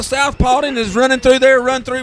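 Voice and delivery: rising stadium announcer energy; excited play-by-play. Acted. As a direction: call the entrance like the stadium is shaking. Live play-by-play of the team entrance.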